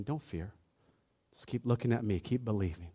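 Jesus speaking softly to us today, tender; gentle whisper-soft. Retold or imitated. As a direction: hush it to the listener. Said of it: "Jesus is looking at us too".

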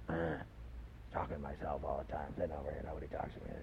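low, soft muttering to himself; mock weirdo mumble. Preacher imitating a park weirdo, low and muttered. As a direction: play the lonely oddball under your breath.

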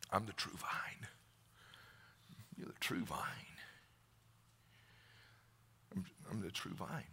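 soft, breathy whisper. Acted. Jesus naming himself the true vine, whisper. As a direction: hush it, let it linger.

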